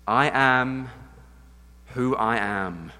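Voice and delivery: slow, weighty reverence; reverent. Acted. Preacher reverently quoting God's name, slow. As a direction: let it land slowly.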